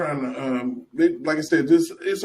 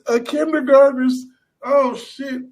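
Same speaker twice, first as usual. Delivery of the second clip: higher, pained disbelief; mock outrage; 11 semitones higher, 5 dB louder than usual. Host mocks seeing her art, higher mock outrage. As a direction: cry out in fake shock. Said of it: "I'm like".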